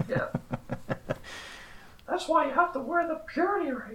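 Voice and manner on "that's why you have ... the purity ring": high, squeaky cartoon shout; Mickey Mouse voice. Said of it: "do a whole episode in that voice".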